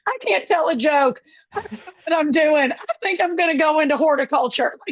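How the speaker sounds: half-laughing, half-crying about a failed joke; laughing despair. Retold or imitated. Retells her own despairing call.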